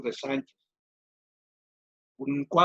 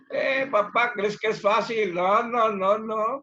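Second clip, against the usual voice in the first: son's voice; 7 semitones higher, slower than usual. Acted. Son voiced in the retold story.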